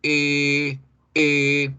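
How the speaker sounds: E with rounded lips; long E. Shown as a demonstration. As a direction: round the mouth for O, say E. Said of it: "en vez de O decíamos E".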